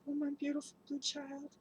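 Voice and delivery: ordinary delivery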